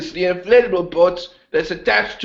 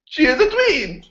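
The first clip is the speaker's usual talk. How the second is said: high mock voice; 9 semitones higher than usual. Repeats line in put-on voice.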